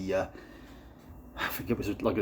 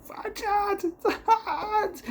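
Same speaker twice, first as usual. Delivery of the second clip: put-on kids shrieking for their dad, much higher and half-laughing; mock scream. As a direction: squeal it like panicked kids, with a laugh. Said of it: "His kids are screaming".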